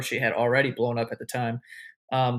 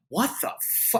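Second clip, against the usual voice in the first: higher, softly voiced mock astonishment; retold disbelief; 7 semitones higher, 4 dB quieter than usual. Friends watching the star hug the speaker, mock disbelief. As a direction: imitate stunned friends.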